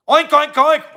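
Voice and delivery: pig oinks. Pretend. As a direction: oink like a pig. Imitating a pig.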